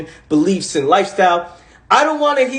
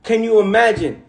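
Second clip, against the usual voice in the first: normal talking voice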